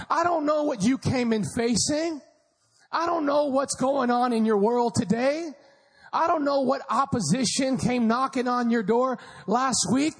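ordinary delivery

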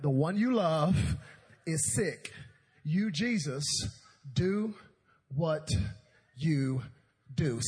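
halting, one word at a time; dictating a text. Acted. Martha types her message to Jesus, word-by-word dictation. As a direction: punch out each word with a long pause after it.

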